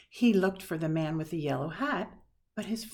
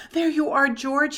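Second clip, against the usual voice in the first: loud and higher, relieved call across the room; crying out; 8 semitones higher, 11 dB louder than usual. A narrator's reading. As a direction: call out in relief at finally finding him. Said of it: "he cried".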